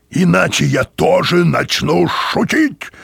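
slow, loud, high threatening bark; barked threat. A narrator's reading. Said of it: "рявкнул он".

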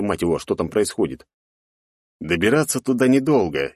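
usual speaking voice

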